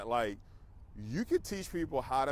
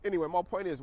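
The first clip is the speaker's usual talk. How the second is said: ordinary delivery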